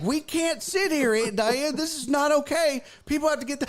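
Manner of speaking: high-pitched, exasperated insistence; urgent pleading. Retold or imitated. Niece begs aunt to move, urgent pleading.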